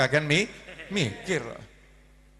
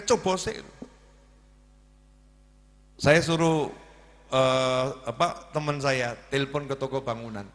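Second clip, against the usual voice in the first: usual speaking voice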